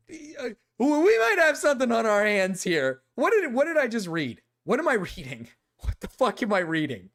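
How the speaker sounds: high, loud incredulous ranting; aghast at what you're reading; disbelief. Real, not acted. Shocked by creepy message.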